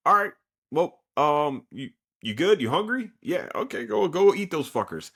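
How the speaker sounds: high, sing-song coddling; mock coaxing. Korosuchi sending his monster baby to eat, high coaxing. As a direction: talk to it like a pet.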